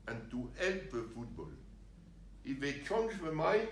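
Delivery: ordinary delivery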